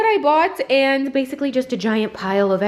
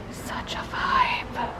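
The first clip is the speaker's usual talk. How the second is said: whisper; 9 dB quieter, much slower than usual. Real, not acted. Awed by glittery stocking.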